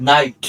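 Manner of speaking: নাইট said with no K sound, starting on N; silent K. Shown as a demonstration. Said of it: "কে উচ্চারণ হয়নি".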